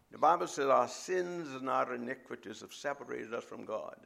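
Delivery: usual speaking voice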